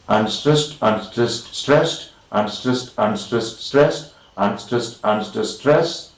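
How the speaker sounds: da-da-DUM chant, pitch up on "stressed"; anapaestic stress pattern. Shown as a demonstration. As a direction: count out the beat, lifting every "stressed". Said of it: "First two unstressed and next stressed".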